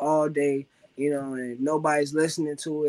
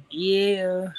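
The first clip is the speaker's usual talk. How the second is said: closing yeah ad-lib; 6 semitones higher, 4 dB louder than usual. Acted.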